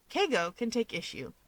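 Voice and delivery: usual speaking voice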